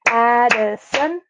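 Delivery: Addison slowly split into three beats, Ad-di-son; syllable by syllable. Shown as a demonstration. Teacher counting beats in a name, Addison syllable by syllable. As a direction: clap out Addison, each syllable drawn out. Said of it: "she has three beats".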